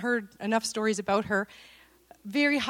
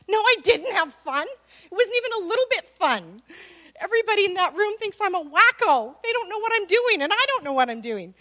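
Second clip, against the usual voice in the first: higher and louder, fed up and frazzled; exasperated outburst; 9 semitones higher, 5 dB louder than usual. Retold or imitated. To her husband after the playgroup, an exasperated outburst. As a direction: let the frustration burst out high.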